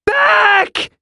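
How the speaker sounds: loud, high-pitched scream. A narrator's reading. Kaya grasping wildly in a fight, screaming. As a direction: scream it out at the top of your range. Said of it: "Kaya screamed".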